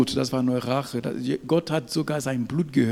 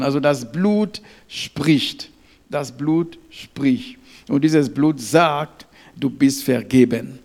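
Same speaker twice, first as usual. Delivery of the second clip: full-force preaching; 8 dB louder than usual. Real, not acted. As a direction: declare each promise with mounting force. (laute, kraftvolle Verkündigung)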